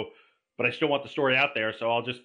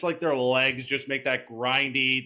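usual speaking voice

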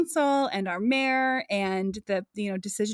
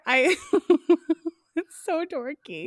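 helpless, embarrassed giggling; laughing; 5 semitones higher, slower than usual. Real, not acted. Coworker sheepishly confessing a coping hobby, laughing. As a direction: break into laughter while confessing. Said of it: "Why are you laughing so much?".

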